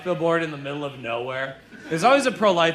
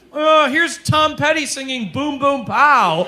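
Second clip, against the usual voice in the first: higher, louder, slow mock enthusiasm; mock excited tech hype; 9 semitones higher, 7 dB louder, slower than usual. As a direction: hype it like a cheesy demo. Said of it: "now they're like".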